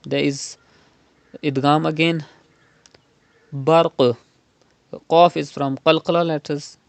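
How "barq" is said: Barq with bouncing echo on qaf. Shown as a demonstration. Teacher shows qalqala on a final qaf, bouncing. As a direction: rebound the qaf at the end of Barq. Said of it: "You have to do qalqala".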